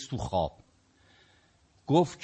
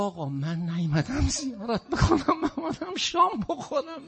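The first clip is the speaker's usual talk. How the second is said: playful pilgrim voice; 12 semitones higher than usual. Acted. Pilgrim answers the Imam.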